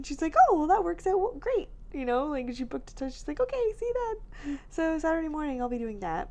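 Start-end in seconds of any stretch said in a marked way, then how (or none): 0.5-1.7 high, chirpy quoted reply; cheery therapist. Retold or imitated. 3.4-4.6 high, sing-song "see you then"; sing-song goodbye. Retold or imitated. Quoting therapist booking.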